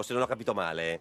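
normal talking voice

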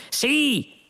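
high-pitched curt confirmation; impatient yes. A narrator's reading. Lunqual confirms to the stalling computer, impatient. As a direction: answer impatiently, pitch leaping up.

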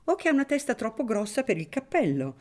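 maliciously. A narrator's reading. Don Ciccio mocking the priest's honesty, sly tone. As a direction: slip in a mischievous jab. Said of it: "osservò maliziosamente".

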